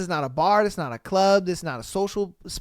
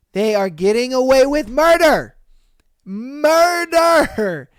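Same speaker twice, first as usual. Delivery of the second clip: loud, higher, indignant cry of murder; real outrage, voice pushed up and out; outraged exclaiming; 5 semitones higher, 6 dB louder, slower than usual. Real, not acted. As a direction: hammer the word murder in disbelief. Outraged rant about cheating women.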